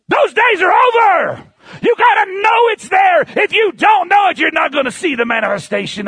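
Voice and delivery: loud, high shouted preaching; preaching at full force. Acted. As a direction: thunder it out to the congregation. Preaching peak.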